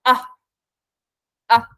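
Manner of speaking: relaxed short "ah" schwa; schwa sound. Shown as a demonstration. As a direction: say "ah" loose and lazy, like the schwa. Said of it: "It sounds something like".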